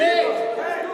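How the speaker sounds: loud high hey; calling out. Acted. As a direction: shout for help across the lake. Subjects told to shout.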